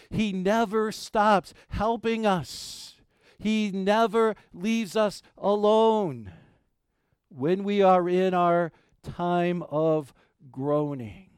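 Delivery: slow, high, deliberate preaching; emphatic preaching. Acted. Pastor assuring congregation God never leaves, slow emphatic preaching. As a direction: hammer each word home slowly.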